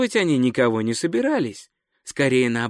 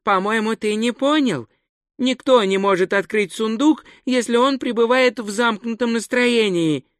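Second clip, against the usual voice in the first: high-pitched, patient tourist voice; mildly patient, high character voice; patient explaining; 9 semitones higher than usual. A narrator's reading. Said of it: "терпеливо сказал турист".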